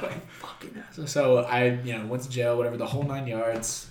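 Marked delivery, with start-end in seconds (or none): 0.0-1.1 barely voiced, much softer grumble; muttered comeback. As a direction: say it under your breath so they don't hear. Muttering back at cops.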